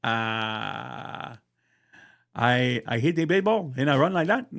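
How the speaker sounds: fast, laughing mock Dominican accent; Dominican player accent. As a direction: imitate the Dominican player haltingly. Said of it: "He's like".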